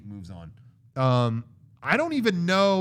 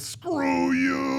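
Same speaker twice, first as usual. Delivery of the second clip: high, drawn-out mock shout; Arnold's movie yell; 11 semitones higher than usual. Retelling the drill kill, drawn-out hammy shout. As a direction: stretch both words into a strained battle cry. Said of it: "I think Arnold goes".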